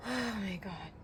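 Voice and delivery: soft, tearful, ending in a long sigh; real overwhelmed near-sob; close to crying. Real, not acted. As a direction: sigh it out softly, fighting tears. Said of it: "I never really cry on camera".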